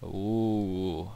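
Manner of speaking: usual speaking voice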